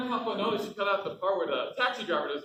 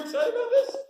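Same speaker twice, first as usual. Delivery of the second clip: excited laugh; 6 dB louder than usual. Acted. Eager comic pitching his big break.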